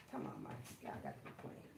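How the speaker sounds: breathy hushed aside; whisper. Real, not acted. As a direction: say it under your breath. Hushed to the dogs.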